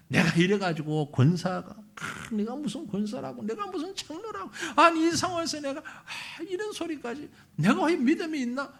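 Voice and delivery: high-pitched acted lament; imitated self-reproach. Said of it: "막 힘들 때 쏟아놓고".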